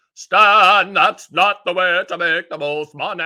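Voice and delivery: booming, drawn-out voice from on high; annuity sales gods. Acted. Sales gods calling down to the annuity man, booming. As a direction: call down loud and stretched like an angry god. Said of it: "that's what they sound like in my head".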